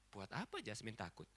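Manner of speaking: soft, low, simple reassurance; calm trust. Retold or imitated. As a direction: answer gently, as a trusting child. Child's trusting answer, quoted softly.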